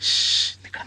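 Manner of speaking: urgent whisper, shushing; whispering while hiding. Real, not acted. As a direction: whisper so nothing hears. Hiding, hushing companion.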